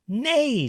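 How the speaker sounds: high falling neigh; whinny it like a horse. A narrator's reading. Reading to children, a high horse neigh. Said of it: "said the horse".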